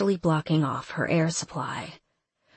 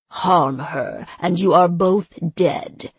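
louder menacing snarl; snarled threat; 4 dB louder than usual. Acted. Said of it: "he snarled".

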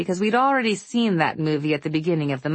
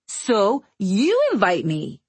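duh voice; 4 semitones higher, slower than usual. Acted. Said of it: "in a duh voice".

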